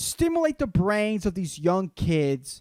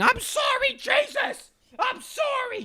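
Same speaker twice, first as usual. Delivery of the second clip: falsetto mock shriek of a tomato; shrieking cartoon tomato begging; mock high scream. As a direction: squeal it way up high, hammed-up. Bob screaming during sex fantasy.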